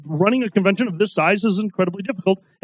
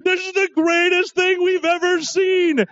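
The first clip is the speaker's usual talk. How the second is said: gushing; 9 semitones higher than usual. Retold or imitated. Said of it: "watching the show like".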